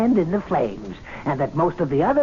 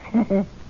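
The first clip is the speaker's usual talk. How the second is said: a dry little laugh; amused snort of a laugh; short amused chuckle; 3 semitones higher than usual. Acted. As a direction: chuckle in disbelief. Laughing at Ashley again.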